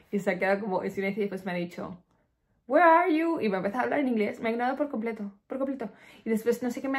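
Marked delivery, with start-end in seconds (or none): 2.7-3.4 high, loud retold question in English; mock loud reply. Driver answering her bad French on the phone, mock loud reply.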